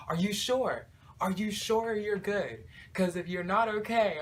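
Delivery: high, loud, pressing concern; worried friend. Retold or imitated. Friend Jasmine pleading with him at the gas station, high and loud.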